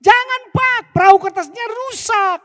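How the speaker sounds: put-on very high, loud child's scream; a boy begging dad to stop; shrill high pleading yell. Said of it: "anaknya tambah teriak".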